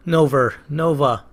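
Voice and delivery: a quick Boston twang on Nova; Boston accent. Shown as a demonstration. Hearthstone player joking in Boston accent. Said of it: "That's my Boston accent coming through there".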